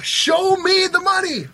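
shouted catchphrase. Shown as a demonstration. Host shows his partner the motto with a yell. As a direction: belt it out like a movie hero. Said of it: "doesn't it make you feel good just to say it".

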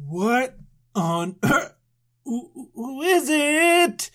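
slow, puzzled and high by the end; old Abram hearing a voice from nowhere; startled old man. Retold or imitated. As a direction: ask slowly, confused and wary.

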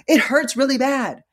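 loud, high imitated complaint; upset patient. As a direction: complain loudly to the nurse, mimicking pain. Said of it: "He was upset".

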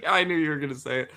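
laughing high while talking. Real, not acted. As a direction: giggle through the line. Laughing at own name mix-up.

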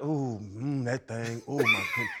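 low, soft seductive voice; sexy deep voice. Retold or imitated. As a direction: purr it deep and slow. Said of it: "I'll be like".

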